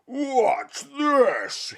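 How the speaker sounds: very high, loud giant yell; yelling monster. Acted. Thirsty Fossifu spotting the rain clouds, high yell. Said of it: "he yelled".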